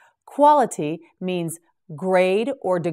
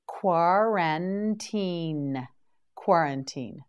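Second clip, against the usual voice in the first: slow model word; 4 dB quieter than usual. Shown as a demonstration. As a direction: stretch out Quarantine slowly, syllable by syllable. Teacher models word three times.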